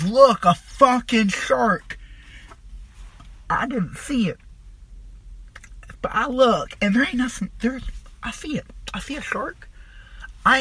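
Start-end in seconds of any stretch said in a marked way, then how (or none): 0.0-2.0 imitating a drawn-out, higher-pitched cry of alarm; mock yell, stretched out slowly; retold yell. Older friend messing with the tripping newcomer, mock yelling. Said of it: "he yells". 8.2-9.7 high, hushed reenacted dread; mock frightened realisation. Tripping man believes the shark is there, mock hushed fright. Acting out seeing imagined shark.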